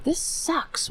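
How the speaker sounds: breathed out under the breath; real frustrated whisper. Real, not acted. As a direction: hiss it to yourself in disgust. Frustrated at stream mishap.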